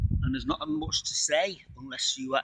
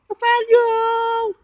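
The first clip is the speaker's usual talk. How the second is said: calling out; 9 dB louder, much slower than usual. Retold or imitated. Calling the name mum chose.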